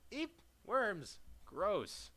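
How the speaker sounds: high squeal sliding into loud disgust; high, loud yelp of disgust; grossed-out squeal. Real, not acted. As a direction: squeal at the worms, then recoil. Sees worms in game.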